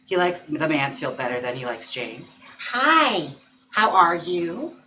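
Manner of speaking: ordinary delivery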